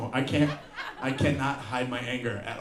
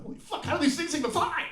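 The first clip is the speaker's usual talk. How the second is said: mock panic. Passengers panicking at takeoff.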